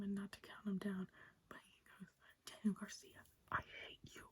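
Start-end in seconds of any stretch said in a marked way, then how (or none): 2.5-4.2 lips still, words pushed through teeth; through clenched teeth. Retold or imitated. Mocking Eddie's promo, words through clenched teeth. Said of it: "He says like that. No mouth moving, all teeth".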